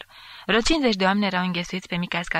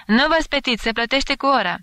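higher, louder jeering call; heckler's shout; 6 semitones higher, 5 dB louder than usual. A narrator's reading. An unknown joker heckles the fencers, shouting. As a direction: call it out over a crowd. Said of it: "care strigă".

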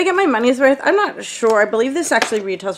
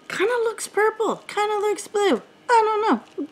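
much higher, playful pondering voice; sing-song unsure; 13 semitones higher than usual. Pretend. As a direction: toss it off in a high little singsong. Unsure of nail polish colour.

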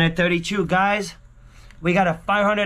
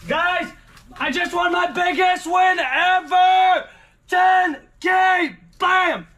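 excited shouting; 9 semitones higher, slower than usual. Real, not acted. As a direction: yell the win out in joy. Still celebrating the big win.

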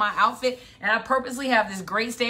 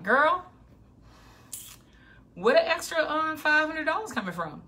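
higher, mock-disbelieving "Girl"; incredulous question; 6 semitones higher than usual. Listener doubting the extra money, mild mock disbelief. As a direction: play the doubtful student, lightly. Audience asks where money comes.

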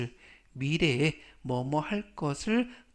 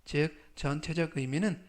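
ordinary talk

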